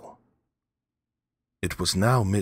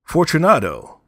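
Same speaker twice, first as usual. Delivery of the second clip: loud high call into the dark; calling aloud; 10 semitones higher, 11 dB louder than usual. A narrator's reading. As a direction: call his name into the niche. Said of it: "I called aloud".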